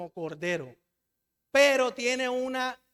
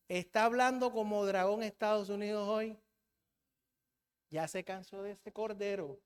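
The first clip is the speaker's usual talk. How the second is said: normal talking voice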